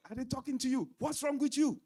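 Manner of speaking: high, loud, fast angry scolding; aggressive. Shown as a demonstration. Husband demonstrating an aggressive reply to his wife, loud angry scolding. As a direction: snap at her like an accusing spouse.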